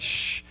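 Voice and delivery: soft, imitated shushing; hushing shh. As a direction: hush a friend with a quiet shh. Hushing his crying friend.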